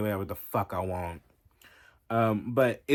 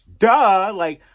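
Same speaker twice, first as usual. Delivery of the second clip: a sudden, much higher and louder sarcastic "Duh!"; loud mock duh; 10 semitones higher, 16 dB louder than usual. Real, not acted. Vlogger scoffing at her own obvious point, loud sarcastic blurt.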